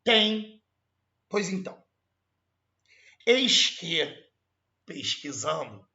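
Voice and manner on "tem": "tem" as one emphatic nasal syllable, higher and louder; everyday pronunciation. Shown as a demonstration. Teacher insisting on how people say it, emphatic pronunciation. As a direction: punch "tem" out as a single syllable. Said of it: "você fala tem".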